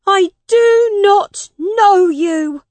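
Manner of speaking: slow, drawn-out high yell; emphatic, stretched-out disowning cry; shouted. A narrator's reading. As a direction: shout it word by word, high and loud. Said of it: "Tom shouted at his mother".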